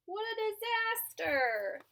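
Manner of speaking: plain reading voice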